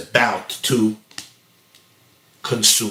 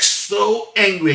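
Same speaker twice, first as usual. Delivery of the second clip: slow, higher, drawn-out emphasis; retold seething anger; 8 semitones higher, slower than usual. Husband telling how he felt toward his wife, acted seething anger. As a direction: stretch it out in acted exasperation.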